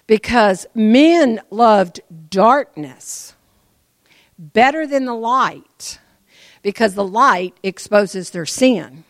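slow, loud, drawn-out preaching. Real, not acted. Preacher driving the point home, slow loud preaching. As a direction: stretch each word out loudly.

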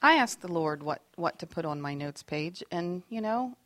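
normal talking voice

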